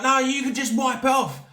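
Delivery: lightly mimicked high, brushing-off voice; mocking dismissive woman.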